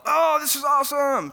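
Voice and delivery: put-on high, loud childlike delight; a five-year-old thrilled; kid excited. Five-year-old self quoted.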